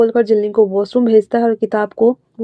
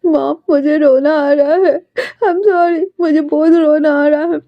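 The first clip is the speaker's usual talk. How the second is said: high, slow, tearful voice, near sobbing; a boy breaking down in tears before his mom; crying; 5 semitones higher, slower than usual. A narrator's reading. Jinling cries to his mom, tearful and high. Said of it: "Jinling कहता है".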